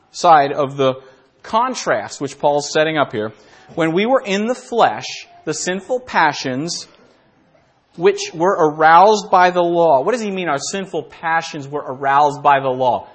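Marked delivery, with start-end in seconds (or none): 3.7-10.0 preaching emphasis. Acted. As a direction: lean hard into the scripture line. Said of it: "preacher reading the verse".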